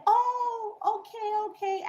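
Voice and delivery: high, fake-pleasant okays; mock-cheerful high okay; playing it off. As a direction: brush it off in a sugary high voice. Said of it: "then I played it off".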